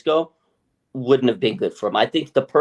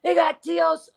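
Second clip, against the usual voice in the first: high, loud, excited fan squeal; high overjoyed fan imitation; mock fan excitement; 14 semitones higher, 4 dB louder than usual. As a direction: cheer it like thrilled fans, slow. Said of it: "everybody went fucking ballistic".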